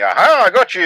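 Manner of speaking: loud, high gloating shout; mock triumphant yell. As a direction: crow like you've caught someone out. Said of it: "people fucking thinking".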